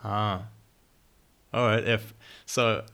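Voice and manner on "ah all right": ordinary talk, nothing marked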